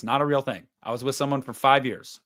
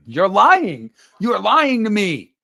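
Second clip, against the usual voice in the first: mock accusation; 6 semitones higher, 7 dB louder, slower than usual. Real, not acted. Accusing streamer over chat comment.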